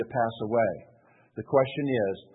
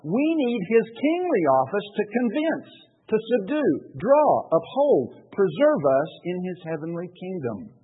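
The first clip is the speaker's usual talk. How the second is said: preaching; 6 semitones higher, 4 dB louder than usual. Real, not acted. Pastor preaching the confession to the congregation, rising fervor. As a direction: lift the list to a preaching climax. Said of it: "preaching the confession at full force".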